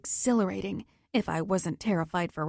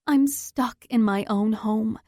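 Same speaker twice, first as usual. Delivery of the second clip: ordinary delivery, nothing marked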